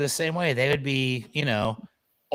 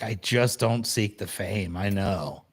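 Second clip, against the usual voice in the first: low, slow, understated star dog; lightly voiced dog, lower and aloof; voicing the dog; 7 semitones lower, 5 dB quieter, slower than usual. Host speaking for the camera-shy dog, low and aloof. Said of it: "He's like".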